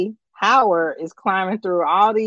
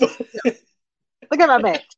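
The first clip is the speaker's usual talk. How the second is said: slow, high mock cop voice; 5 semitones higher, much slower than usual. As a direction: flash the badge, hammy and slow. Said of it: "Just flip it real fast".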